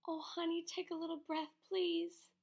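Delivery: high, hushed desperate begging; desperate pleading. A narrator's reading. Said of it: "said Bryce".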